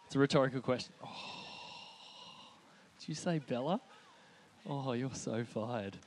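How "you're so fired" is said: ordinary talk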